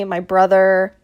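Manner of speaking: ordinary talk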